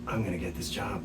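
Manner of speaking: very soft private thought; hushed, confident inner monologue. Retold or imitated. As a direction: murmur it secretly to yourself. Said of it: "in my head, I went".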